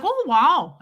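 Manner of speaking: high, loud Midwestern marveling; Midwestern accent. Retold or imitated. Stranger marvels behind the traveler, Midwestern accent. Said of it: "it was a sympathy accent".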